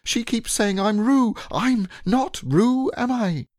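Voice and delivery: pleading piglet. Acted. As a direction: beg for help.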